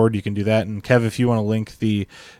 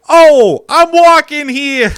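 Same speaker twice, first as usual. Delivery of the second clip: put-on loud, high New York accent shout, drawn out slowly; New Yorker yell; 11 dB louder, much slower than usual. Crossing in front of a car, yelling at the driver in a New York accent. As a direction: bellow it like a New Yorker cut off in traffic. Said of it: "yelling at the guy like a New Yorker".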